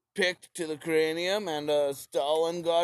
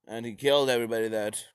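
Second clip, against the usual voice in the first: usual speaking voice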